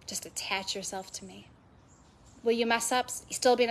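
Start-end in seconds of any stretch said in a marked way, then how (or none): 0.0-1.5 soft voice of God. Retold or imitated. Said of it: "It's like".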